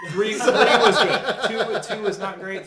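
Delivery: high, loud laughing exclamation. Real, not acted. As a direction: laugh the verdict out, slowly and loudly. Laughing excited gaming opinion.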